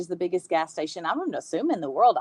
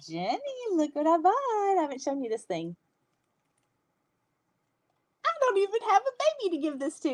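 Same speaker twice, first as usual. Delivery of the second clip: high sing-song call to a friend; 10 semitones higher than usual. Real, not acted. Calling friend Jenny to see her purchase, high sing-song call. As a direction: raise your voice high, calling across to someone.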